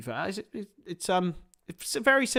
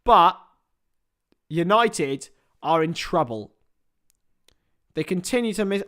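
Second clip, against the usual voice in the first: ordinary delivery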